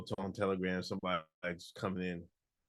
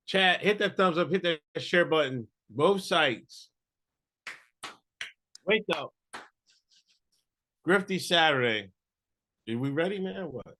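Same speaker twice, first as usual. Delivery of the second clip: loud, high hype-man call; hype shout; 9 semitones higher, 9 dB louder than usual. Real, not acted. Cohost shouting at the chat to like and share. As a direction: pump up the chat.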